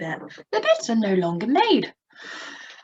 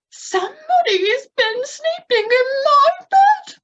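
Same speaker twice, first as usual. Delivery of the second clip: much higher, louder squeaky mama bear; high Mummy Bear voice; 6 dB louder, slower than usual. Shown as a demonstration. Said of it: "we'll use a quite high voice".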